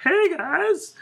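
high, loud hyped-up greeting; fake radio cheer. Guest meant to lie on the radio, fake cheer. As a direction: squeal hello like a gushing radio guest. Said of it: "you're meant to be like".

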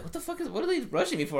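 high-pitched incredulous question; mock bafflement. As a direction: imitate a monster baffled by its attackers. Acted quote, baffled Beast Titan.

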